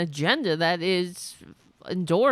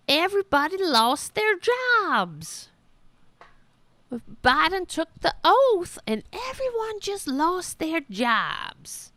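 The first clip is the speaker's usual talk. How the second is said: sarcastic higher voice of a paranoid voter; mocking paraphrase; 7 semitones higher than usual. Host mocking voters' beliefs, sarcastic raised voice. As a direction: ham up the conspiracy in a raised, mocking pitch. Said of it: "That's how they really believe".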